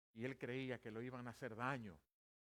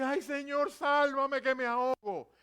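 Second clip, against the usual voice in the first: high, loud drowning plea; slow, drawn-out yell for rescue; desperate cry for help; 10 semitones higher, 15 dB louder, slower than usual. Acted. Pedro sinking, crying to the Lord, desperate yell.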